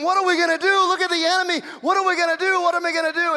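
high, loud panicked pleading; acted panic. Servant Gehazi to Elisha seeing the army, panicked. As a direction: cry out in mounting alarm.

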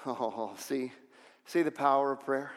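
ordinary talk, nothing marked

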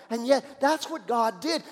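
ordinary delivery

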